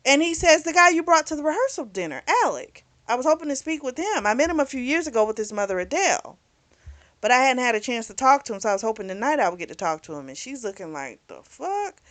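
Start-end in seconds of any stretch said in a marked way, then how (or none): 0.6-10.3 fast, higher babbling retell; quick imitated chatter; rushed explanation. 11.3-12.0 high, soft mock what-the-heck; imitated incredulous aside; stunned disbelief. Character's stunned reaction.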